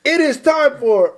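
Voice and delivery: high, loud segment announcement; showman's fanfare; hype announcer. Pretend. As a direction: build to a big reveal.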